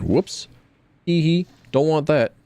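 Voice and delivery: ordinary talk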